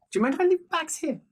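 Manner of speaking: high, fast, fussy question; Lucas voice. Acted. Lucas asking the host about bags, high and quick. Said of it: "Lucas goes".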